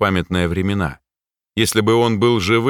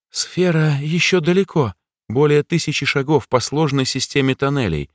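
put-on mouse girl voice; 6 semitones higher than usual. A narrator's reading. The mouse speaks.

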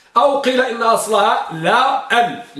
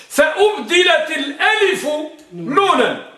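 emphatic call; 6 semitones higher than usual. Shown as a demonstration. Teacher emphasizing the rule.

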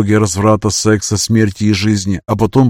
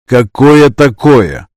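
impatient; 4 semitones higher, 6 dB louder, much slower than usual. Acted. Hero losing patience.

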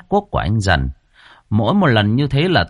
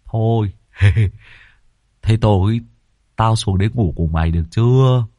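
mock comfort. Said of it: "dở giọng an ủi".

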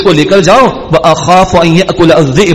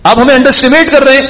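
much higher, whiny mock offence; brothers' offended tone; 9 semitones higher, faster than usual. Brothers repeating to their father that he underestimates them, high and whiny. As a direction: push the complaint up high like a sulking son. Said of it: "یہ بھی tone ہے ہمارے یہاں کی".

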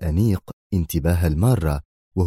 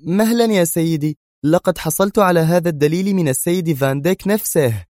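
tense woman interrupting; 10 semitones higher than usual. A narrator's reading. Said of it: "قاطعته في توتر مشابه".

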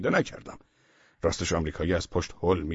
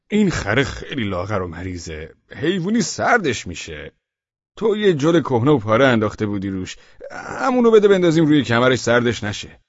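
louder, pleading, rising to a whine; mock pity; 5 semitones higher, 7 dB louder than usual. Narrator faking pity to the villager, louder. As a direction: fake concern for the donkey.

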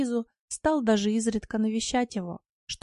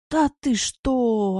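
loud, slow aghast gasp; gasping shock; 3 semitones higher, 6 dB louder, much slower than usual. A narrator's reading. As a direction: gasp in disbelief. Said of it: "ахнул Василий". (ахнуть от ужаса)

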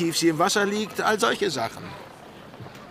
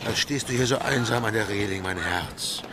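teasing tender; 6 semitones lower than usual. Acted. Captain teasing his lover.

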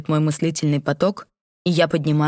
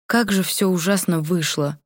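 usual speaking voice

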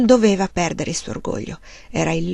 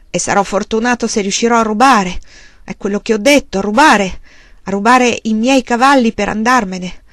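louder, biting, hammering rubare; savage outburst; 6 dB louder than usual. Acted. Husband turns savagely on his wife, louder and biting. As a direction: turn on her wildly. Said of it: "si rivolse a lei selvaggiamente".

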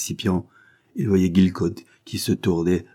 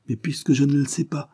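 higher, a frightened cry; cried out trembling; 8 semitones higher than usual. A narrator's reading. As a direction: protest in terror. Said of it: "s'écria Gilcott frémissant".

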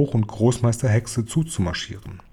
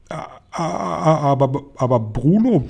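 stammering; 4 semitones higher than usual. A narrator's reading. Dumbfounded father addressing his mouse son, stammering. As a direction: stumble repeatedly into the first word. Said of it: "stammelte Mister Jenkins wieder".

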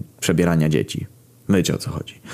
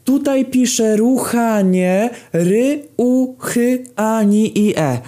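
much higher, slow, loud, spelling out each syllable; shouting; 3 dB louder, much slower than usual. Retold or imitated. Narrator shouts to the cheering crowd, spelling loudly. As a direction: yell it to the crowd, hammering every letter. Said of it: "krzyknąłem".

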